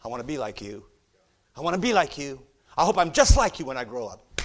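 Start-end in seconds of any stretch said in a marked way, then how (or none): 1.6-4.4 eager admiration. Retold or imitated. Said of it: "Yes, ma'am".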